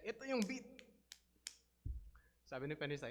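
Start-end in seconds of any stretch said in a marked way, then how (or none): 0.1-0.6 louder, higher insistence on ito yung beat; impatient bandleader correcting the pianist; insistent pastor. Retold or imitated. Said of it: "sabi niya".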